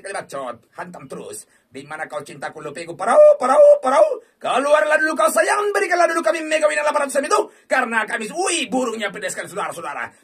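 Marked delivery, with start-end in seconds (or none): 3.0-7.4 high, loud, rising plea; frantic shouted pleading to the game. Real, not acted. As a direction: beg the machine out loud. Begging the slot for a win.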